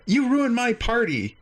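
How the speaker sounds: a let-down host complaining; whiny alien host. Retold or imitated.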